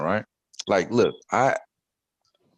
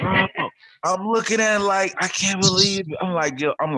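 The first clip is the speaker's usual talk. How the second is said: excited; 12 semitones higher, 5 dB louder than usual. Real, not acted. Amazed at Bitcoin price.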